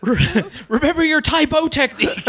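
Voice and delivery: high voice breaking up with laughter; laughing mock coaching. Fighter playing along with the corner bit, laughing. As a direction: squeal the advice through giggles.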